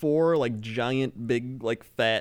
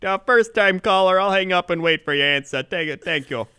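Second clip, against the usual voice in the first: hurried radio host; 6 semitones higher, 7 dB louder than usual. Retold or imitated. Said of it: "It's just like".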